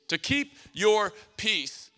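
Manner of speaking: "To keep your peace" at half speed, each word set apart; slow right down on "To keep your peace"; emphatic repeat. Real, not acted. Preacher announcing three ways, "To keep your peace" slow and emphatic.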